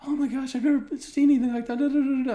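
high, loud breathless gush; gushing girlfriend. Retold or imitated. Guest mimics the girlfriend gushing over the scuffle, high and loud. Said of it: "she was like".

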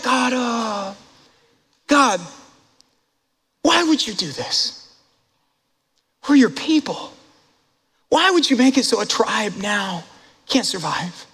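a mock whiny plea to God, put-on voice; whiny complaint. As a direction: pout it out like a self-pitying child. Mocking Israel's complaint to God.

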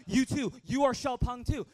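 loud, emphatic, naively grateful reply; eager returned compliment. Retold or imitated. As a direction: throw the compliment back with gusto. Boy proudly returns the supposed compliment.

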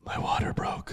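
breathless hushed report. Retold or imitated. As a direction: breathe it out quietly. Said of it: "she says".

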